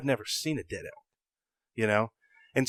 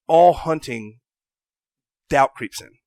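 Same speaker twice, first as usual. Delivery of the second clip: ordinary delivery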